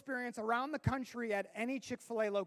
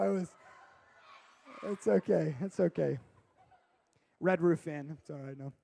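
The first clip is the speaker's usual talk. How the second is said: ordinary talk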